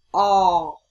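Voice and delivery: loud open o; short o vowel. Shown as a demonstration. Teacher recapping the short o sound. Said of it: "đối với âm ngắn".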